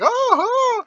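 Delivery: very high imitated shriek; short, high-pitched pretend screams; mock scream. Retelling Miss Peacock being pushed, mock screaming.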